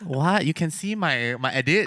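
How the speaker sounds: laughing, loud mock disbelief; retelling his own shocked reaction with a laugh; retold shock. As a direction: act out the shock through a laugh. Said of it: "I was like".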